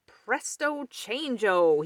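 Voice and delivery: slow, loud magic words; cried out. Acted. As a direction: cast the card spell with flair. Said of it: "he cried".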